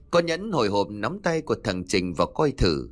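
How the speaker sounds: ordinary delivery, nothing marked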